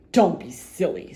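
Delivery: gruff scornful retort; Uppity scoffs at the goblin; snorting. Acted. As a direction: snort it dismissively. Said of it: "snorted Mister Uppity".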